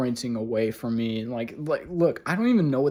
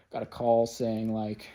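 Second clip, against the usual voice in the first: usual speaking voice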